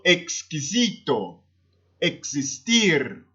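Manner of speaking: "exquisito, existir" slowly, with x sounded ks. Shown as a demonstration. Teacher giving Spanish X examples, slow ks in "exquisito, existir". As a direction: draw out "exquisito, existir", clear ks in each. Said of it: "se pronuncia como X".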